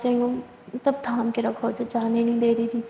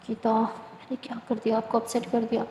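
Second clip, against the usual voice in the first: soft regretful murmur; 7 dB quieter than usual. Acted. Bhoomi regrets upsetting Geeta.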